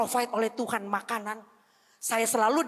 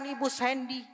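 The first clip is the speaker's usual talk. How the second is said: mild imitation of a weeping parent, higher; higher, pleading parent's voice; tearful parent; 5 semitones higher than usual. Parent phoning the pastor about a lost child, mildly tearful. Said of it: "menangis, katakan".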